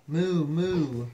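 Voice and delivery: loud double moo; cow moo. Acted. Reader reviews the cow's sound, mooing loudly. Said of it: "let's review".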